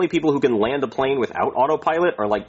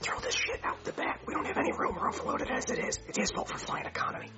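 whisper; 13 dB quieter than usual. Acted. Pilot's aside to the flight attendant.

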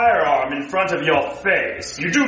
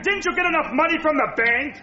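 usual speaking voice

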